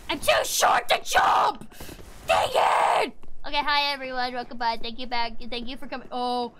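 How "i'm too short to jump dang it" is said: harsh, high shout; exasperated raspy outburst; frustrated yell. Real, not acted. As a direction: yell it hoarsely at the game. Failed jump, yelling.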